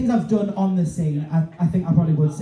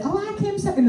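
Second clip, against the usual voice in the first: much higher mock voice of a smug friend; imitating Stacey; 13 semitones higher, 3 dB quieter, faster than usual. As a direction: squeak it out like she's showing off. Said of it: "Stacey always likes to say".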